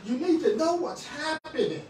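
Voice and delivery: ordinary talk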